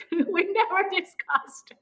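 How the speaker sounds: laugh, then squeak it out slowly; real laugh carried into high-pitched words; laughing insistence. Real, not acted. Laughs while repeating her point.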